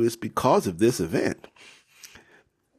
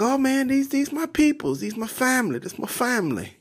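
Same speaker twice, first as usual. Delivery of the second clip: Jacob showing off family. Acted. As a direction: answer pitched way up, then settle. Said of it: "And Jacob's like".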